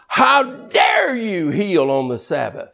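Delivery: slow, outraged cry of a scandalized Pharisee; hammed-up pious outrage; indignant Pharisee. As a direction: sound shocked and offended. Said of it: "And the indignant Pharisee".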